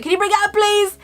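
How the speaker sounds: high pleading call upstairs. Retold or imitated. Panicking at home she begs Adam upstairs, high and pleading. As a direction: call up to him, desperate.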